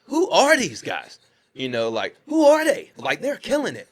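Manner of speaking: high, loud amazed exclamation; amazed crew. Retold or imitated. Film crew stunned by the dancers, high and loud amazement. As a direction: play the stunned crew gushing.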